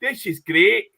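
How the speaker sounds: loud, hearty Scottish brogue; Scottish accent. Pretend. Guest greets the hosts in a hearty Scottish accent. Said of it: "I really wanted to do a Scottish accent".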